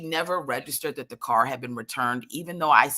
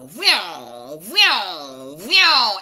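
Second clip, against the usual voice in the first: imitated vroom, swooping up and down; loud passing-car noise; cars whooshing past; 2 semitones higher, 6 dB louder, much slower than usual. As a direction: mimic speeding cars with a sliding voice. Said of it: "there's cars going".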